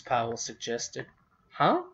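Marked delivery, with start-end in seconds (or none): 1.5-1.8 high, sharp yelp of surprise; shocked huh. A narrator's reading. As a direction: blurt it out in disbelief. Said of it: "mouth dropped open in protest".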